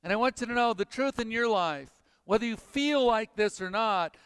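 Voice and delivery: usual speaking voice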